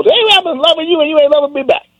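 high, put-on voice for the wronged friend; mock complaint to a friend. Caller confronts a friend who won't love back, high put-on voice. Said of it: "now you got something to really go".

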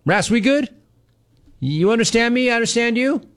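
teasing. Real, not acted. Teasing caller, mock check-in.

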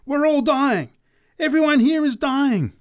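very high, loud preacher's scream; screamed prophecy. A narrator's reading. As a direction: scream doom over the crowd. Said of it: "raised her voice to a scream".

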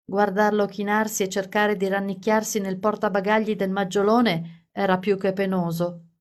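usual speaking voice

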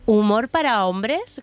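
¿humor para hombres? drawn out, rising sharply on hombres; slow, incredulous question, hombres swooping upward; huge question mark. Real, not acted. Commentator challenging the streamer's excuse, huge questioning rise on hombres. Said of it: "con una interrogación enorme".

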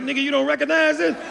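high disbelieving squeak; incredulous. Retold or imitated. Comic retelling his reply to the guard, high incredulous squeak. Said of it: "I was like".

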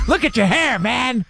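high-pitched mock dad voice; hammed-up disapproving dad; dad impression. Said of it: "Do the impression, please".

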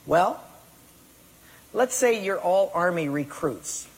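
normal talking voice

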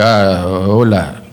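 slow, lightly put-on bad Spanish accent; a hint of mangled Spanish, drawn out; broken Spanish. Seagal pandering to a 911 caller, mock broken Spanish accent. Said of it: "horrifically broken Spanish".

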